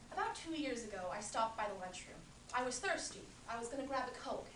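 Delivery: a woman recounting a strange night with Barry, wry and lively; Linda monologue. Acted. Actress tells audience about her night with Barry, wry and amused. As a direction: tell it like confiding a juicy story to a friend. Acted Talk Radio monologue.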